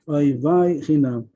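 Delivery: ordinary delivery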